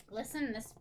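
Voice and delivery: ordinary talk, nothing marked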